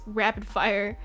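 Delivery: normal talking voice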